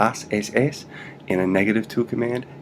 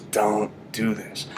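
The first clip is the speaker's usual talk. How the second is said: slow warning; much slower than usual. Shown as a demonstration. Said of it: "you're telling somebody".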